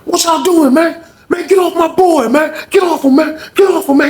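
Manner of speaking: high-pitched retold yell; urgent yelling. Yelling to break up fight.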